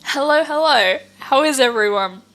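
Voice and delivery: ordinary talk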